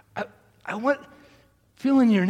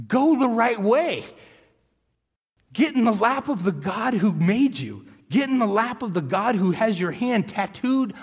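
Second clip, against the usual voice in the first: louder, rising pulpit appeal; passionate preaching; 5 semitones higher, 3 dB louder than usual. Acted. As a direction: plead with the congregation. Preaching climax.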